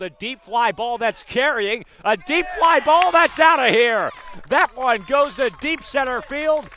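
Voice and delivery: big, loud, soaring home run call. Real, not acted. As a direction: let it rip as the ball leaves the yard. Home run call, rising crowd moment.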